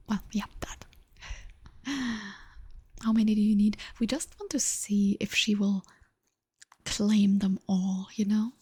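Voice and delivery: steady ASMR whisper. Real, not acted. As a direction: whisper close to the mic.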